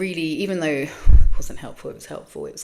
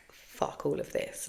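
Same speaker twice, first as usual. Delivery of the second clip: mock muttered quote; 3 semitones lower, 7 dB quieter, slower than usual. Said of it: "I was just like".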